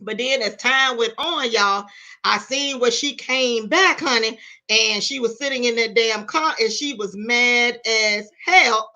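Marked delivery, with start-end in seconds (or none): none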